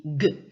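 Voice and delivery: G said as a hard guh. Shown as a demonstration. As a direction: give G the hard sound, as in go. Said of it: "alors souvent on dit". (« G » prononcé dur, gue)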